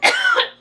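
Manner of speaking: sudden cough mid-word; coughing on something swallowed; coughing fit. Real, not acted. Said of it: "think I just swallowed a bug".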